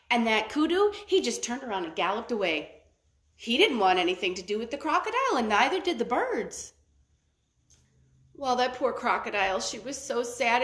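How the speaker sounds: ordinary delivery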